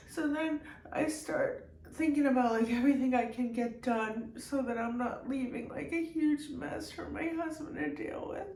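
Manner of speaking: high-pitched, talking on through tears; tearful. Real, not acted. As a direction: push through the crying to explain. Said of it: "I'm so sorry I'm crying".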